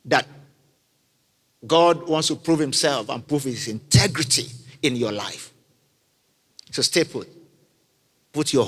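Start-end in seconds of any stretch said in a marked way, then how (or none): none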